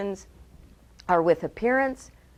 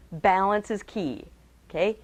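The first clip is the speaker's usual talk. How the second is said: normal talking voice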